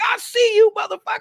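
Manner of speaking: very high, shouted call-out; squealing high-pitched tease; teasing shout. Retold or imitated. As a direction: yell it across lanes like the lady did. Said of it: "she was like".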